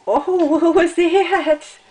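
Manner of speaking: loud, slow, startled cry; loud surprised. Real, not acted. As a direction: react to a sudden noise. Baby makes a sudden noise.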